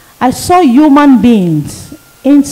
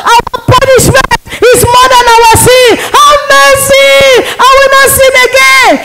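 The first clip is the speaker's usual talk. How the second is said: begging the damned; 10 semitones higher than usual. Retold or imitated. Preacher acting out souls in hell, shrieking for mercy. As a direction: scream for mercy as if burning.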